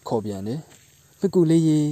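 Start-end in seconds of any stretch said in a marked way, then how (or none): 1.2-1.8 calling out. Acted. Cow calls out to spider.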